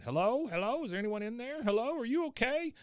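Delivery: high, rising calls through a door; calling through the door. Retold or imitated. Someone outside knocking and calling to Bobby, high. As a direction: call anxiously into the bathroom. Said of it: "somebody on the outside saying".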